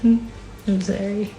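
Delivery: real tearful apology, drawn out. Real, not acted. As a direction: apologize while holding back tears. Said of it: "supposed to be crying right now".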